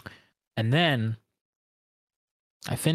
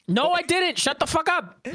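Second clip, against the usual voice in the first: high, indignant denial; indignant outburst; 13 semitones higher than usual. Real, not acted. Protesting friends' claim, high indignant denial. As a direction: snap back at friends teasing you.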